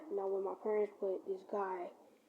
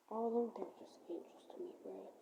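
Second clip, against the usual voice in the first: whispered; 10 dB quieter than usual. Real, not acted.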